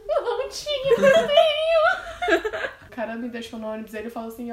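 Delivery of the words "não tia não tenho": very high, loud, drawn-out mock wail; hammed-up sobbing plea to an aunt; retold desperate crying. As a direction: wail it slowly through laughter. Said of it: "eu chorando, desesperada".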